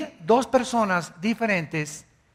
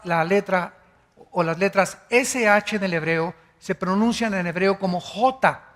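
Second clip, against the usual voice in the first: ordinary talk, nothing marked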